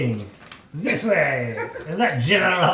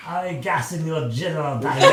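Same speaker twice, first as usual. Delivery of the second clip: mock French taunt; 5 dB louder than usual. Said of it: "Monty Python".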